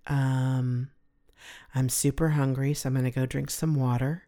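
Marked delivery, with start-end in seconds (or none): none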